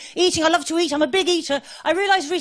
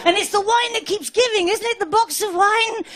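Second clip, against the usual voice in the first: higher, giddy delight; gleeful boast; 6 semitones higher than usual. Acted. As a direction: crow about it, tickled. Gleeful boast about the box.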